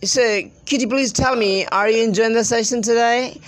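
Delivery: high-pitched affected English accent; put-on foreign accent. Shown as a demonstration. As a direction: ask politely in a showy put-on accent. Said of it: "so I enjoy speaking like that".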